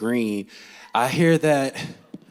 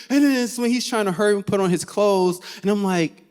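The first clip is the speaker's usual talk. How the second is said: ordinary delivery, nothing marked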